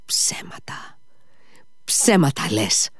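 whispered hiss rising into voice; accusing cry. A narrator's reading. Mitya accuses Fenya of lying, hissed and angry. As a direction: hiss the accusation, then draw it out slowly. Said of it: "φώναξε ο Μήτια".

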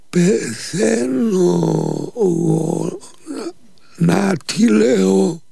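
high, slow, strained and broken, words forced out; a freezing man gasping out that he is dying. Acted. Freezing Nikita to his master, high and broken, straining to speak. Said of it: "με πολύ κόπο και με κομμένη φωνή".

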